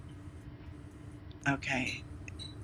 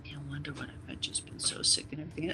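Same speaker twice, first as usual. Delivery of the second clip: 1 mock sad whisper; 3 semitones lower, 8 dB quieter, faster than usual. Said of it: "she started off so sad".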